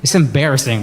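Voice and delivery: usual speaking voice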